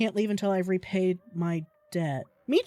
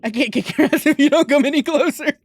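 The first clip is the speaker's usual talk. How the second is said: yelling a challenge, higher and louder; loud taunt; 7 semitones higher, 10 dB louder, faster than usual. Pretend. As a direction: shout it like a fighter calling out. Taunting the fish to fight.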